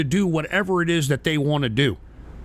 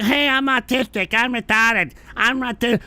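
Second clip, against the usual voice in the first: slightly raised mock voice of pretenders; a hint of a put-on, higher voice; mocking imitation; 4 semitones higher than usual. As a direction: lightly mimic the chatters in derision. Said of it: "pretend to be like".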